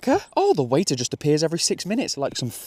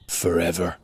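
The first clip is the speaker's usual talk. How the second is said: deadpan fan; 9 semitones lower than usual. Retold or imitated. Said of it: "You know what they said?".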